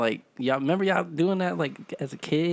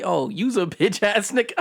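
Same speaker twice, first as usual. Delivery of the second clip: higher-pitched imitation of women insulting him; mock harsh insult; 5 semitones higher than usual. Imitating women calling him out, mock harsh insult. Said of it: "it wouldn't come off as harsh".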